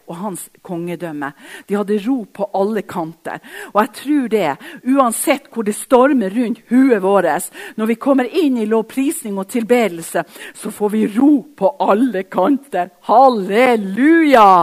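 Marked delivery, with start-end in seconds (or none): 3.7-14.5 fiery revival preaching; preaching at full force. Acted. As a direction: drive it home to the congregation. Preaching climax ending Halleluja.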